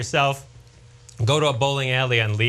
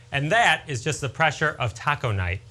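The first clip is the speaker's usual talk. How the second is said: ordinary delivery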